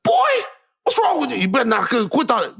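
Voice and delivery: high, sharp mock scolding; scolding dad. Dad scolds son for crying, stern. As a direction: imitate a dad who won't allow tears. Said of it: "But that's not what he did".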